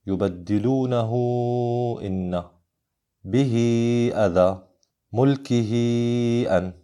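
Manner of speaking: long sustained oo or ee before "إن", "أذى", "أن"; long connecting madd. Shown as a demonstration. Teacher reads major connection examples, "يبدلونه", "به", "ملكه" with held endings. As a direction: hold the last vowel of "يبدلونه", "به" and "ملكه". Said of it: "الأمثلة على الصلة الكبرى".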